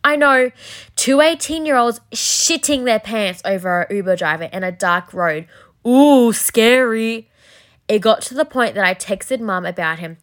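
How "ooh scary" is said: slow, loud sarcastic "Ooh, scary"; mock spooky drawl, dripping sarcasm. Mocking own fear.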